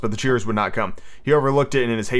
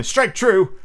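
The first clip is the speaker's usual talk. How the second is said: high, resolute command; firm and emphatic, pitched up; 9 semitones higher, 4 dB louder than usual. A narrator's reading. Toodles offering himself to Peter's arrow, firmly. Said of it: "he said firmly".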